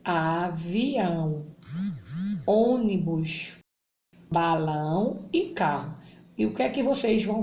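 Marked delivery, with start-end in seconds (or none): 0.0-6.0 slow reading aloud. Shown as a demonstration. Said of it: "Ao lado tem canoa".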